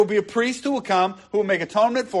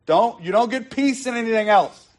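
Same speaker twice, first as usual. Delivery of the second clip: normal talking voice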